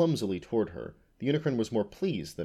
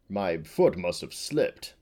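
lightly voiced slow farmer; slow, lightly acted excuse; fat farmer; 3 dB louder, slower than usual. A narrator's reading. Farmer excusing his failed lunge at the unicorn, slow. Fallen man excuses himself.

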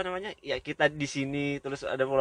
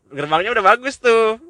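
seruan kagum bernada tinggi dan keras; kagum dibuat-buat; 11 semitones higher, 12 dB louder than usual. Retold or imitated. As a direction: tirukan orang yang terkesan, suara melonjak. Menceritakan kekaguman melihat gerbang wisata. (seruan kagum yang dilebih-lebihkan)

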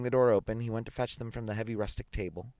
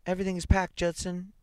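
wife's voice; 11 semitones higher, 4 dB louder than usual. A narrator's reading. Wife calls out to husband.

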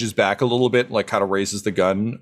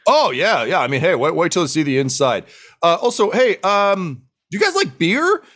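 eager dwarf host, rising high in pitch; proud, hospitable dwarf; 6 semitones higher than usual. Acted. Dwarf invites new friends inside for beer, eager. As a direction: build to a high, hopeful offer.

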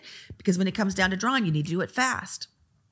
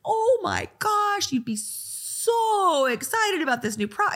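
much higher, slow, gushing squeal; gushing excitement; 11 semitones higher, slower than usual. Shown as a demonstration. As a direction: gush like a thrilled new client. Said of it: "time to talk like this".

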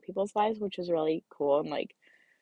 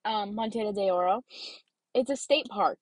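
ordinary talk

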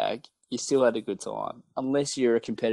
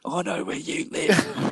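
slow, higher mock threat; menacing heckler; 6 semitones higher, slower than usual. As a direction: repeat the threat slowly. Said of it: "echo of the bloke".

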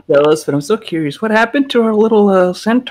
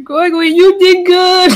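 high excited cheer, louder; 11 semitones higher, 4 dB louder than usual. Acted. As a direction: gush with pride. Player cheers Gregory in character.

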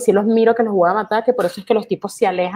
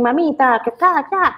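catcaller imitation; 5 semitones higher than usual. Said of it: "en Venezuela te dicen".

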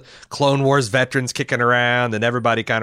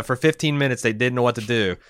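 ordinary talk, nothing marked

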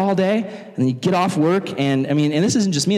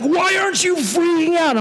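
high-pitched mock outburst; exasperated mom; 13 semitones higher than usual. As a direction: imitate a mother driven crazy. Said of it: "it drove my mom crazy".

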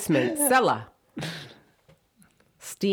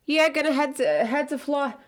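higher, retiree voice; Rhode Island retiree; 8 semitones higher than usual. Retold or imitated. Mimicking retirees talking about moving south, Rhode Island voice. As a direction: imitate an old local heading south. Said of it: "they're always like".

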